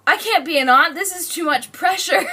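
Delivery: hammed-up overwhelmed outburst; mock overwhelmed protest. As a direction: playfully act out being under too much pressure. Aunt protests under pressure.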